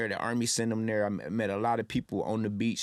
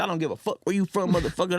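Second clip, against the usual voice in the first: 1 angry retort; 7 semitones higher, faster than usual. Retold or imitated. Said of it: "He said".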